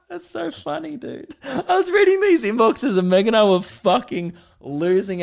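high, squeaky words through laughter; wheezing out words mid-laughing fit; helpless laughter. Real, not acted. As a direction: talk while cracking up, voice shooting up. Can barely talk for laughing.

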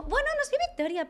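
mock-feminine, very high-pitched voice; put-on "voz de pito", mocking imitation; imitated woman's voice. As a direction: mimic a woman in a squeaky high voice. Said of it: "como si fuera con voz de mujer".

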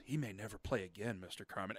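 low, soft grave verdict; hushed doctor's verdict. Retold or imitated. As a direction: break the bad news gently.

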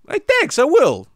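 squeaky high-pitched thanks. Acted. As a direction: chirp it way up high. [name] answers excitedly.

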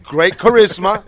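high, loud maître d' patter; host directing traffic. Retold or imitated. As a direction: dole out tables like a busy host. Imitating Nico doling out girls.

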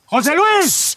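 loud, high shout of a name; shouting a lost friend's name; calling out. Acted. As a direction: yell for him across the dark field. Calling out in dark field.

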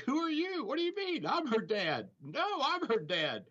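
high-pitched imitation of squabbling dads; mock indignant quarrel. Storyteller imitating two fathers arguing over a daughter, mock indignant.

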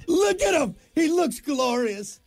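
host showing his co-host a photo, thrilled; excited exclaim. Real, not acted.

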